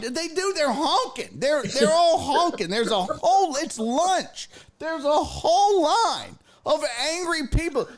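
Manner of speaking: high-pitched, insistent arguing; exasperated protest. Retold or imitated. Niece warns aunt of honking line, exasperated.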